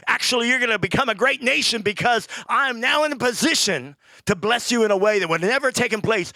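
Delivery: loud, high proclamation; preaching at full force. Real, not acted. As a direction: declare it over them.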